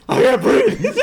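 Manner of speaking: high, loud mock-panicked shout; retold out-loud cry. Man finally gets words out to his wife, loud high cry. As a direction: blurt it out like a hammed-up yell. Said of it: "vocally out loud".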